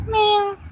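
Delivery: squeaky imitated meow; playful meow; high meow. Meowing at the cat.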